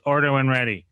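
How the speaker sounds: loud, abrupt order-taker imitation; drive-through worker. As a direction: imitate a curt worker on the speaker. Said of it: "the person goes".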